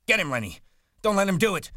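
high, loud, urgent yell; frantic shouted order. A narrator's reading. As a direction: urge your friend to fight back at full voice.

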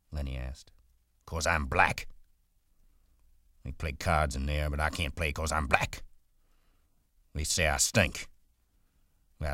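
proud, bitter stable hand; bitter Crooks. A narrator's reading. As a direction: push Lenny away with resentment. Voices Crooks in story.

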